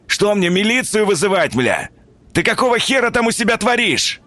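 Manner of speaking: high, strained yell; almost yelling through the door; near shout. Acted. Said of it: "он чуть не в полную глотку заорал". (почти крик)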